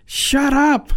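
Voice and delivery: mock yell, pitched high without much volume; retold scream. Preacher retelling a phone call to his sister, mild scream. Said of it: "I screamed at her on the phone".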